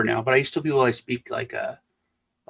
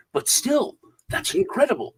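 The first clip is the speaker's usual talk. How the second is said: high-pitched mock amazement; imitated wonder, pitched well up; retold insistent awe; 10 semitones higher than usual. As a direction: insist like an impressed, gullible listener. Retold listeners insist they are amazed.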